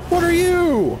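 startled squeaks at a weird monster; shrill mock alarm; high alarmed surprise. Real, not acted. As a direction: pitch jumps up in disbelief. Sees strange enemy.

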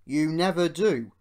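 loud, firm rebuke; stern voice of authority. A narrator's reading. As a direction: cut in sternly and loudly. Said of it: "said a stern voice".

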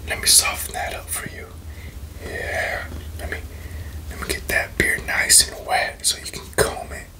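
normal talking voice

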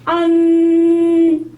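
안 drawn out long, high and loud; slow 안 with a nasal end. Shown as a demonstration. Teacher modelling a long nasal 안. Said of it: "이거 연습이 좀 필요해요".

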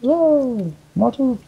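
mock surprise.

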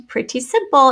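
normal talking voice

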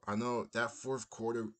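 normal talking voice

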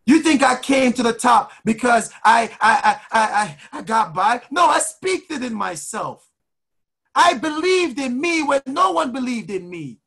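high-pitched, fervent exhortation; motivational preaching. Real, not acted. Coach urging listeners to speak freedom into existence, fervent preaching. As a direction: preach it in punched, repeating phrases.

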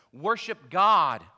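loud, slow, lifted command; ringing scriptural imperative; emphatic command. A narrator's reading. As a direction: proclaim it forcefully, each word weighted. Angel's command in scripture reading.

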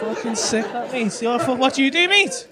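ordinary talk, nothing marked

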